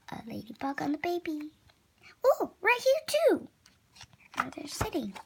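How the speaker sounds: breathy whispered discovery. Real, not acted. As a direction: whisper it so as not to scare the ladybug. Whispered ladybug spotting aside.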